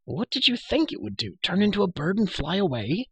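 put-on high-pitched, scornful girl's retort; mock his surprise in a high girlish voice; sarcastic girl, exasperated. Girl to prisoner amazed by her glowing bauble, sarcastic.

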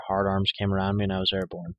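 ordinary delivery, nothing marked

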